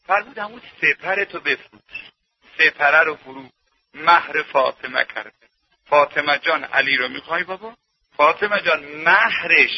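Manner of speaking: low, soft voice for intimate dialogue; hushed sermon storytelling. Acted. Preacher retells Fatima's marriage proposal, hushed storytelling. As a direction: draw listeners in with a quiet tale.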